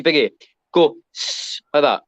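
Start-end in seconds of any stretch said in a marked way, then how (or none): 1.1-1.6 long unvoiced "sss"; sustained s, no vowel; extended S. Shown as a demonstration. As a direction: hold a snake-like hiss on "s-". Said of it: "you say, actually".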